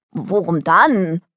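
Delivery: slow words through a foamy mouth; bubbling. Acted. Daughter questioning her mother through soap foam, blubbering. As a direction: talk round a mouthful of soap, mildly. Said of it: "blubberte Delly".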